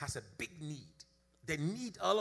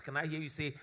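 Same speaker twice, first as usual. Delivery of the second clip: normal talking voice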